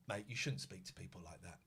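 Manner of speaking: quiet calm telling-off. Retold or imitated. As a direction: keep it calm, defuse him. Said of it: "I kept very calm".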